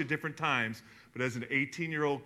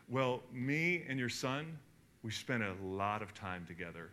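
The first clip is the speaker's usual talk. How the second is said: calm mother; 4 semitones lower, 8 dB quieter than usual. Retold or imitated. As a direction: gently explain with quiet assurance. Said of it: "She goes".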